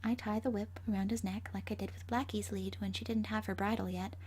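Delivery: usual speaking voice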